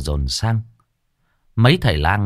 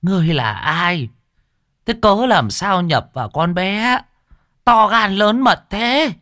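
shaman scolding; 10 semitones higher than usual. Acted. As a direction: demand the spirit name itself. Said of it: "chỉ tay mà quát".

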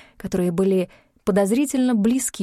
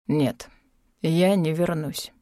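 flat refusal; 5 semitones lower, slower than usual. Acted. Mor refuses, low and slow.

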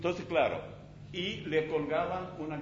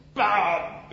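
explosion sound; 5 semitones higher, 7 dB louder than usual. Retold or imitated. Mine explodes underfoot.

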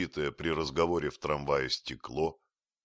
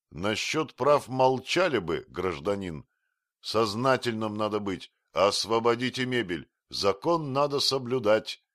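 stern scolding; 5 semitones higher, 5 dB louder than usual. A narrator's reading. Stern bailiff scolds the protester.

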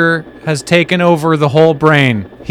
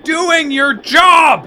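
exasperated shout; 11 semitones higher, much slower than usual. Acted. Exasperated at co-host's nonsense.

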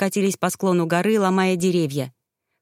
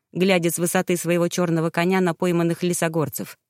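ordinary delivery

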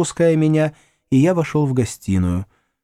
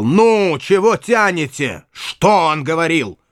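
громкий нетерпеливый крик, медленнее; заори от нетерпения; 5 semitones higher, 3 dB louder, slower than usual. Acted. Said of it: "и он вдруг заорал". (сорвавшийся крик)

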